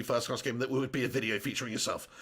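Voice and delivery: low, quiet mock put-down; mock disapproval. Streamer teasing a viewer before admitting the joke, low quiet deadpan. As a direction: drop down low and soft, pretending to disapprove.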